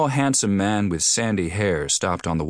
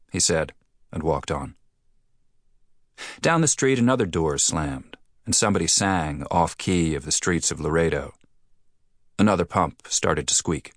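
ordinary delivery

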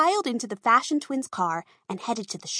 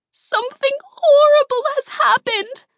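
high, loud, tearful wail; crying out; 8 dB louder than usual. A narrator's reading. Twins crying to Miss Kahn about disaster, high loud wail. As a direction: cry it out in distress, high and loud. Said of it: "they cried".

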